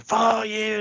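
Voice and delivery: high mock yell of a furious guest; retold scream. Talk-show guest screaming at the camera, mock yell. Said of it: "people, like, screaming".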